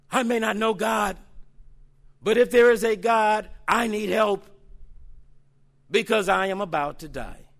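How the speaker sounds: mild voicing of a dying person; desperate plea. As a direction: plead like someone about to die. Voices dying person's prayer.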